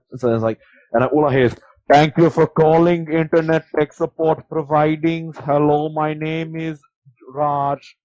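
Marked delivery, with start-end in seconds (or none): 1.9-7.9 put-on slow, louder call-centre greeting in an Indian accent; thick Indian accent. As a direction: Indian accent, careful and formal. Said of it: "the thickest Indian accent I've ever heard".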